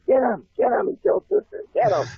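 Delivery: bullies yelling. Retold or imitated. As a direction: scream it like a gang of bullies charging. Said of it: "I could hear 'em screaming, yelling".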